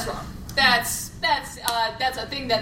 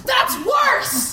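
outraged shout; 12 semitones higher than usual. Real, not acted. Repeated outburst.

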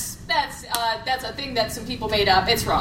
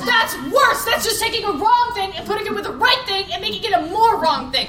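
outraged shout; 8 semitones higher than usual. Real, not acted. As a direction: blow up at the host in outrage. Contestant exploding at wrong answer.

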